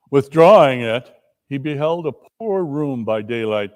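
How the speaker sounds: ordinary delivery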